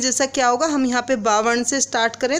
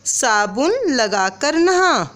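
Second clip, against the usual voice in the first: slow, clear, sentence read as dictation; measured teacher dictation; 2 semitones higher, slower than usual. Shown as a demonstration. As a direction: read it out for writing. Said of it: "इसी तरह से हम लिखेंगे".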